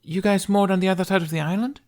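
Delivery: put-on high, bold girl's voice; cocky Viking girl. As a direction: brag like a tough young thief. Kamikaze questions him.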